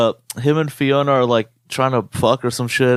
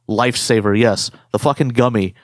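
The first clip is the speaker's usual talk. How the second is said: usual speaking voice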